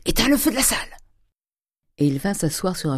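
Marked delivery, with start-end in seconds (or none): 0.0-1.0 lightly voiced old miser barking; a hint of a harsh old father; gruff order. Grandet orders the servant. (ordre bourru du vieil avare)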